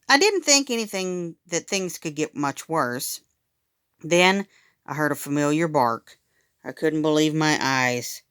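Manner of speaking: plain reading voice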